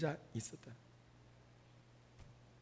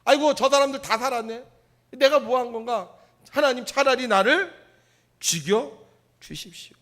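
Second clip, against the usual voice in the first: louder, higher, exasperated outcry; bitter complaint; 5 semitones higher, 8 dB louder than usual. Retold or imitated. Preacher voicing Jonah's bitter outcry to God. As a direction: throw the plea up to God. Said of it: "그러니까 뭐요?".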